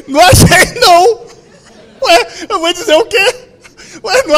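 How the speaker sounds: shouting. Retold or imitated. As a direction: yell it from the other room. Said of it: "Aí eu só gritei assim".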